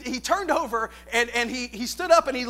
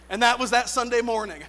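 usual speaking voice

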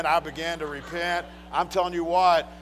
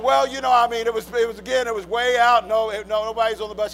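flustered excuse; 5 semitones higher, 6 dB louder than usual. Retold or imitated. Acts himself pleading his excuse.

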